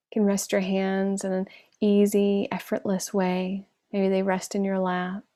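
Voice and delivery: unhurried meditation voice with long pauses; guided meditation. Acted. Teacher guides online class to scan the body, calm meditation voice. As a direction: settle them gently into stillness, leaving space between phrases.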